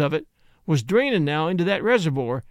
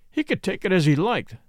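plain reading voice

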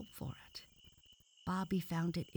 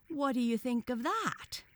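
high, loud crowing challenge; triumphant; 9 semitones higher, 5 dB louder than usual. A narrator's reading. Frog challenges the boy, triumphantly. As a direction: throw down the winning question. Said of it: "cried the frog triumphantly".

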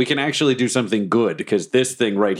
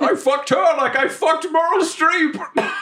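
silly soundbite voice; slower than usual. Pretend. Absurd radio soundbite.

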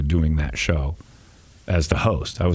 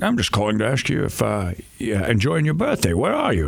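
higher Scottish caller voice; Sean Connery impression; 4 semitones higher than usual. Connery calling Connery, Scottish prank voice. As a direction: play the cheery Scottish caller. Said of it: "I did both voices".

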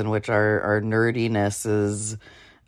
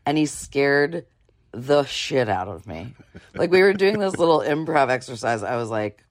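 ordinary delivery

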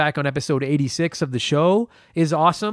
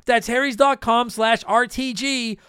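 upbeat ad read; 6 semitones higher, slower than usual. Acted. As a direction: read it like a sponsor spot. Repeats ad URL.

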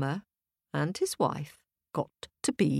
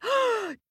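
sharp, high gasp; startled intake of breath; shocked gasp. Acted. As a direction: gasp in amazement. Wife shocked by gingerbread.

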